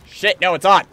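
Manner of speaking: loud, high, fired-up shouting; combat shout. Real, not acted. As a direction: shout it as the fight kicks off. Fight breaks out in game.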